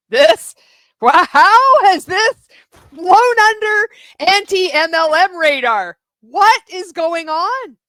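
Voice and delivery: loud, high and drawn-out disbelief; slow, loud, baffled outcry; exasperated shout. Real, not acted. Commentator baffled by the cult announcement, slow loud outcry.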